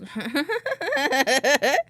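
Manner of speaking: loud laughing burst. Real, not acted. Laugh alone.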